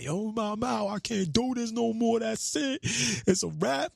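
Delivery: high, slow, tearful wail; crying to his mom that he quits, high slow wail; bawling. Retold or imitated. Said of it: "and I'm bawling in his room".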